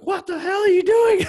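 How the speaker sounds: very high, loud freak-out voice; mock panic. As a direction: yell it high like a panicking inner voice. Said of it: "Like, freaking out and panicking".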